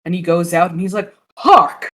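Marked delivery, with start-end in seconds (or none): 1.4-1.9 hammed-up cry of alarm. Sparrow spots weasel.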